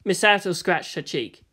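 ordinary delivery, nothing marked

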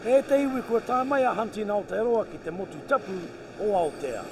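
measured Māori voice-over; documentary narration. Acted. Narrator introducing the hunting show, voice-over. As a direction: tell the story over the footage.

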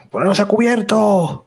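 high-pitched call to everyone; shouted order. Acted. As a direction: shout a warning to the whole crew. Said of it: "grita también a todos".

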